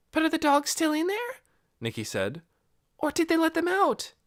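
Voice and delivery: put-on high girl voice; girl worried about the dogs; anxious girl. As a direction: ask anxiously. Nikki anxious.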